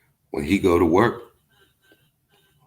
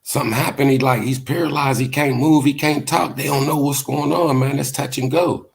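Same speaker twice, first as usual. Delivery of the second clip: higher, worried friend explaining; shaken friend; 8 semitones higher than usual. Retold or imitated. Friend tells Melee the guy is paralyzed, worried higher voice. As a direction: pitch it up like a scared buddy. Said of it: "He said".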